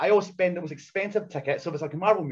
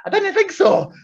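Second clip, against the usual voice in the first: high, loud smug retort; smug put-down; 8 dB louder, slower than usual. Retold or imitated. Putting down the cheeky geek, high, loud and smug. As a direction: sneer it down at him. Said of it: "I was like".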